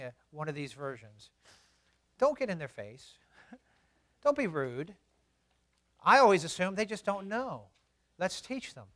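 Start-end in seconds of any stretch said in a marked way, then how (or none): none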